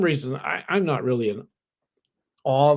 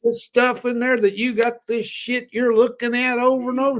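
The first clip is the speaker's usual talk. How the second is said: high, loud, excited retelling of Toby's words; excited imitation; 10 semitones higher, 6 dB louder than usual. Retelling how Toby greeted the writer, excited and high. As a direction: mimic his eager surprise, pitched up. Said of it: "he goes".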